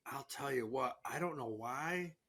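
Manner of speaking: breathy whisper, leaning in close; hushed tip. Real, not acted. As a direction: let the listener in on a secret.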